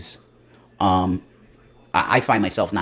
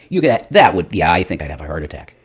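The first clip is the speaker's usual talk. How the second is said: ordinary talk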